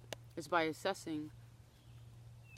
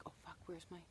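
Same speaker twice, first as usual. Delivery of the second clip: scared muttering; 13 dB quieter, much faster than usual. Retold or imitated. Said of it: "I got scared, right, as an adult".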